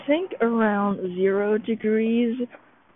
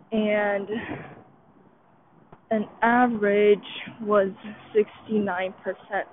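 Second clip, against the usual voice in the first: ordinary talk, nothing marked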